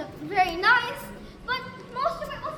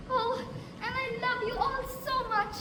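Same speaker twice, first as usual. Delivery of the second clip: normal talking voice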